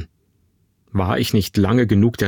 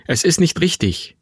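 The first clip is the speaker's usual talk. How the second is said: higher-pitched, pleading protest; troubled objection; 6 semitones higher than usual. Acted. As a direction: object with uneasy conscience. Son objects to the deceit. (gequält widersprechend)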